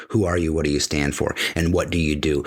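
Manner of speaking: normal talking voice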